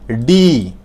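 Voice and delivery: ডি struck hard like a drumbeat; drum-like D. Shown as a demonstration. Teacher showing powerful ডি like a drum. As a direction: hit ডি with a punchy, rhythmic thud. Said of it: "ঢোলের মতো একটা শব্দ হবে".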